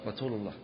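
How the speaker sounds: quiet "ratul" for رسول; t-for-s lisp on رسول; lisp demo. Shown as a demonstration. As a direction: softly say رسول with t for s. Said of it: "السين ينطقها تاء".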